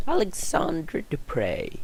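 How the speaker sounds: normal talking voice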